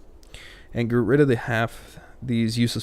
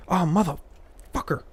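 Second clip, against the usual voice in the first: frustrated curse; 7 semitones higher, slower than usual. Real, not acted. Frustrated exclamation.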